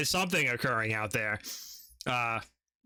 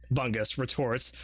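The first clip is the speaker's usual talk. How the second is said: ordinary delivery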